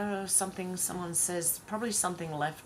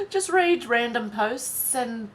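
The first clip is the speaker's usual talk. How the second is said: laughing; 4 semitones higher, 6 dB louder, slower than usual. Real, not acted. Laughing exasperation.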